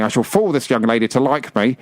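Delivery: normal talking voice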